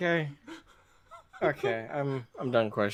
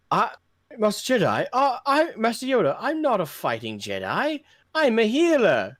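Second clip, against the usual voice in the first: healer quote; 8 semitones higher than usual. Retold or imitated. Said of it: "the statement this big tall guy said".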